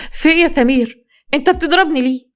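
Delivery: high, loud frightened protest; frightened cry. Acted. Sister crying out as her brother beats her, frightened and high. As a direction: cry out in fear as you're struck.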